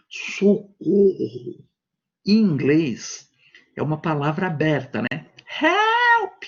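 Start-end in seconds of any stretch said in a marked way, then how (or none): 0.1-1.6 Socorro stretched very slow, sound held inside; enclosed cry. Shown as a demonstration. Teacher showing students how Socorro sounds trapped. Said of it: "a palavra socorro". 5.6-6.4 Help! shouted very high and loud; open shout. Shown as a demonstration. Teacher showing students how Help! carries as a shout. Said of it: "Então você consegue gritar".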